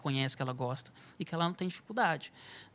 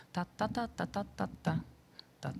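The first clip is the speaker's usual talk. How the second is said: "tá, tá, tá" tapped out in the tune's rhythm, a little higher; rhythm syllables; 3 semitones higher, 4 dB quieter than usual. Shown as a demonstration. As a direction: chant the beat on tá. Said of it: "qual que é o ritmo dessa melodia". (sílabas rítmicas "tá" cantadas no ritmo)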